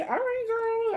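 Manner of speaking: very high, sassy self-cheer; hyped-up cheer. Retold or imitated. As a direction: squeal it like cheering on a friend. Hyping herself up at the beach.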